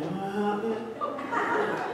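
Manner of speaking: high, rising server imitation; garbled carny server. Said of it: "she does the same thing".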